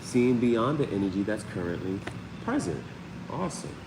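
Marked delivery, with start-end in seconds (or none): none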